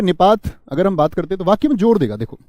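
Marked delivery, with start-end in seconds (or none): none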